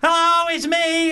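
high, loud and slow "Oh, it's me!"; milk the moment of being recognised; showbiz delight. Retold or imitated. Actress playing up to a passer-by, gushing and slow. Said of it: "she sort of went from that as if".

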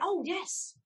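very high, wide-eyed "Oh, yes"; high innocent yes. Retold or imitated. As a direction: play it innocent, voice jumping up high. Claiming her accent is real.

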